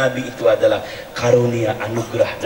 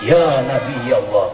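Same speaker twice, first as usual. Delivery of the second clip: husband's voice; 5 semitones lower than usual. Retold or imitated. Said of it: "kata Bapak".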